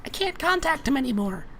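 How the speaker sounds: tiny high-pitched creature voice; Puck. Acted. Unseen creature reports to the prisoner, high-pitched. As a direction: squeak it out very high. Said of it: "the voice, which we know as Puck".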